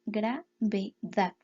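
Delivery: "gravedad" said very slowly, syllable by syllable; slow syllable count of "gravedad"; split into syllables. Shown as a demonstration. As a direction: count gra-ve-dad out slowly. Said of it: "Hacemos lo mismo".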